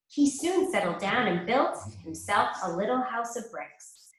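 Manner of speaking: ordinary delivery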